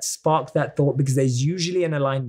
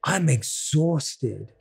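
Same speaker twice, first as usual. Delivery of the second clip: slowly dragged-out words; drawn-out sigh; much slower than usual. Retold or imitated. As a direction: let it sag, slow and tired. Acting out a self-talk phrase.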